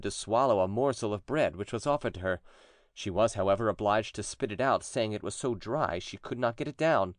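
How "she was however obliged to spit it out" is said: plain reading voice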